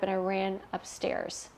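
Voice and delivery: ordinary talk, nothing marked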